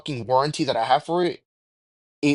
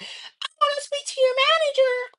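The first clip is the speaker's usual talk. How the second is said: mock Karen voice, way up high; high, whiny manager demand; entitled Karen. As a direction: squeal it like a demanding customer. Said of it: "I don't wanna be that guy".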